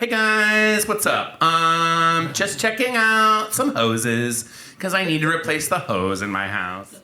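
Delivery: put-on social media greeting, drawn out; Instagram poster. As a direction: mimic someone posting a pointless update. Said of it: "people on Instagram being like".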